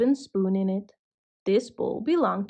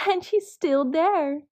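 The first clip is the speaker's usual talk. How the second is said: higher, eager little cub voice; excited squeak; 7 semitones higher than usual. A narrator's reading. Baby Bear pointing out the sleeping girl, excited and high. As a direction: squeak out the discovery. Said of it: "Baby Bear squeaked excitedly".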